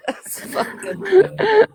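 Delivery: high, slow words through real laughter; laughing while talking. Real, not acted. Friend reacts to the cat portrait, laughing through words. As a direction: say it while still cracking up.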